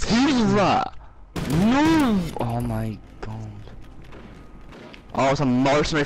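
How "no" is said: sharp cry of protest; frustrated shout. Real, not acted. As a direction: yell it out in dismay. Gets killed in game.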